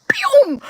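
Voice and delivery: a high, quick "pew" for running off; whoosh sound. Retold or imitated. Telling how fast she fled, a high zippy pew. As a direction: shoot the sound up like a zip. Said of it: "took off like lightning".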